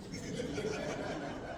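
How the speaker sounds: quiet breathy chuckle; soft breathy laugh. Real, not acted. Speaker amused at family history, soft breathy laugh. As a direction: let a soft laugh slip out. Laugh after retold reaction.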